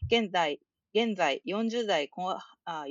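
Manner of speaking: steady, careful read-aloud of a listener letter. Acted. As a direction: read the fan mail out neatly. (リスナーのお便りを丁寧に読み上げる)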